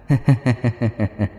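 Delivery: soft eerie ghost giggle; quiet mocking female-ghost laugh; airy spooky giggle; ghostly laugh. A narrator's reading. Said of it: "cái giọng nói eo éo của nữ giới".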